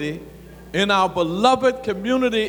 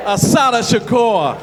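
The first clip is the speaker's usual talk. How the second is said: roaring rallying speech; 3 semitones higher than usual. Acted. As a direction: lead the crowd in a shouted chant.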